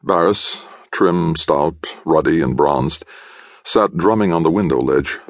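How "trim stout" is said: plain reading voice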